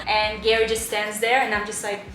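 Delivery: ordinary talk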